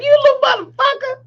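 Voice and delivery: high, mock-furious outburst; retold scolding. Mother retelling cussing out her child, mock anger.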